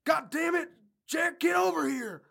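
very high, strained shout; John voice. Acted. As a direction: yell shrilly like a cranky old man. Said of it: "do a John voice".

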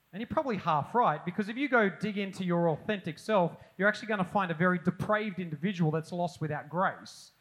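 usual speaking voice

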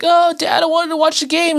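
put-on high, pleading little kid; whiny kid voice. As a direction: beg like a disappointed child. Kid voiced in retold scene.

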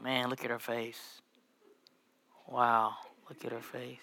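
soft, slow, awestruck murmur; awed murmur. Retold or imitated. Husband gazes at his wife's photos in a soft, slow hush. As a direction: hush it like you can't stop staring.

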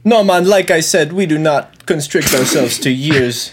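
lightly voiced bad Jamaican accent; mock Jamaican accent. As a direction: insist in the goofy Jamaican voice. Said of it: "I'll do Mike trying to do a Jamaican accent".

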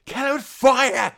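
high, loud, frantic kill it with fire; hammed-up rage while smashing the post box; yelling kill it with fire at the Saturn pad; mock frenzied yell.

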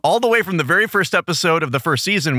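ordinary talk

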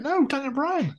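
high, small put-on voice; retold correction. Fan correcting wrestlers who guessed Bray Wyatt, protesting. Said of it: "It's like".

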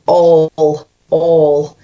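Scottish "all", long rounded vowel; Scottish accent. Shown as a demonstration. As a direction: hunt for the Scottish sound. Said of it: "I've got to go to Scotland".

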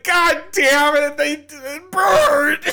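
very high, slow, loud mock wail; hammed-up grief for a fallen comrade; anguished plant cry. Plants find Bert dead and wail in mock grief.

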